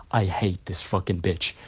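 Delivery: low, quiet venting to a colleague; muttered gripe. Retold or imitated. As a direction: grumble it under your breath, half-retold. Griping about customer to supervisor.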